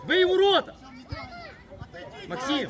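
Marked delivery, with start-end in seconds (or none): 0.1-0.7 loud sideline order; shouted command. Real, not acted. Telling the player to shoot at goal, shouting. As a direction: yell it out to the player.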